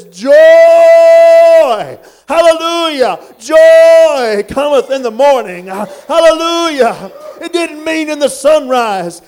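sing-shout it at the congregation; full-force preaching. Real, not acted.

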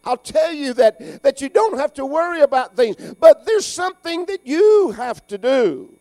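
promise, promise, then the turn, growing loud; voicing Jesus. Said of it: "Jesus says".

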